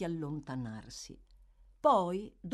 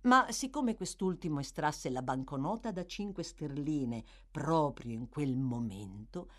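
plain reading voice

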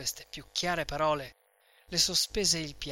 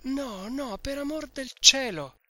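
tearful plea; 9 semitones higher, 4 dB louder than usual. A narrator's reading. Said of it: "il pianto le troncò la voce".

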